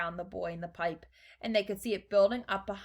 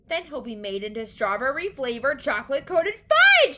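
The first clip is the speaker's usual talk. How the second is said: high, loud horrified outburst; screamed; 6 semitones higher, 10 dB louder than usual. A narrator's reading. Mother learns where the pipe leads, screamed. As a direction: build to a scream on the last word. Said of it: "screamed Missus Gloop".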